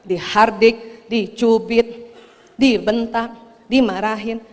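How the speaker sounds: measured, low-key enumeration with pauses; slow listing. Real, not acted. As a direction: spell out each item slowly and deliberately. Slow rhetorical list of punishments.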